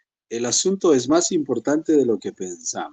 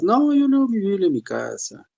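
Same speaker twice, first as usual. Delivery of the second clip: higher, lightly voiced dismissive reply; believer's excuse; 9 semitones higher, 3 dB louder than usual. Believer excuses himself to a churchgoer, higher mock reply. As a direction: brush the question off in a higher voice.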